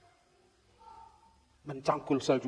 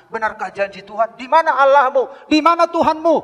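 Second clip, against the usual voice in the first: mocking challenge; 10 semitones higher, 12 dB louder than usual. Voicing mockers shaking faith.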